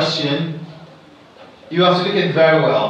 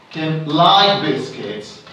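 slow, with "like" carrying the stress; 2 semitones lower, slower than usual. Shown as a demonstration. Teacher repeating the model sentence, slow with "like" stressed. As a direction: press on "like" and finish with a falling tune. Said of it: "the emphasized word here is like".

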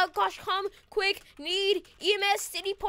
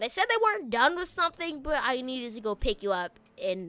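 normal talking voice

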